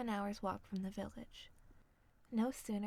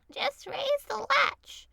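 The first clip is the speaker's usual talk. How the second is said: much higher, loud, slow call; high old woman calling out; grandmother crying out; 13 dB louder, much slower than usual. A narrator's reading. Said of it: "cried Grandmother".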